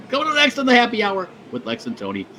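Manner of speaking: talking through hiccups. Real, not acted. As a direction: speak while hiccuping. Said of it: "and I have the hiccups".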